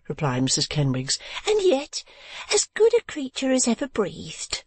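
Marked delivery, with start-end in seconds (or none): 1.5-4.6 very high, gushing lady's voice; fussy wife. A narrator's reading. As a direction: gush over the uncle. Wife praises uncle.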